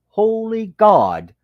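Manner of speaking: slow, high, loud "Holy God" of dismay; retold shocked exclamation. Author reacting to his early novels, shocked and loud. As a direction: gasp it out at your own old writing. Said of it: "I think to myself".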